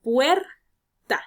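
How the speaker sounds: "Puerta" split, PUER loud and held, TA dropped; stress on first syllable. Shown as a demonstration. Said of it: "tenemos la sílaba tónica puer". ("Puerta" separada en sílabas, con fuerza en PUER)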